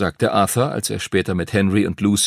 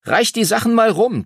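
put-on high-pitched, pushy boy's voice; impatient youngster demanding a turn; impatient urging; 13 semitones higher, 4 dB louder than usual. A narrator's reading. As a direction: press the others eagerly to hurry up. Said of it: "drängelte Timothy".

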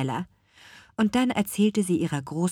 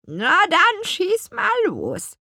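much higher, slow croaking crone voice; croaking old witch; 14 semitones higher, 6 dB louder, slower than usual. A narrator's reading. Grandmother witch invites Petronella to talk, croaking. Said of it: "krächzte ihre Großmutter".

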